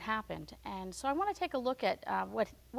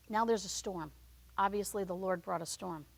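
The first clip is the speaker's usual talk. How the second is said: usual speaking voice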